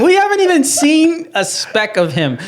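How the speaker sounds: laughing disbelief. Real, not acted. Laughing about the ignored dad.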